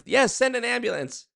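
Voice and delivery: high urgent plea; mock frantic caller demanding an ambulance; desperate caller. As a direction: beg for the ambulance. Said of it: "You're like".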